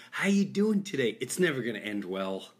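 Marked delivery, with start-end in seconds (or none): 0.1-1.2 higher-pitched, lightly mimicked greeting; imitated beggar. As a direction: mimic a beggar's opening line. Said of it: "when they do".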